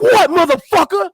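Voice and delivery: high, loud challenging yell; mock street challenge, yelled; confrontational yell. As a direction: get right in his face and yell. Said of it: "you run up in his face like".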